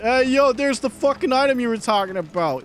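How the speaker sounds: smug gloating tease; mocking taunt. Voicing the game taunting him.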